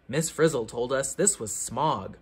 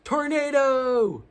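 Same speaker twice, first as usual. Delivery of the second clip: higher, slow, stretched Tornado with a falling end; dramatic announcement; 8 semitones higher, 4 dB louder, slower than usual. Acted. Reader announcing the next chapter to kids, slow dramatic call. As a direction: call it out like a warning siren.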